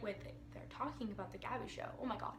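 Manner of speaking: very soft, low, stunned undertone; hushed realisation. Retold or imitated. Realising they mean the Gabby Show, hushed. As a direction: drop to a tiny stunned murmur.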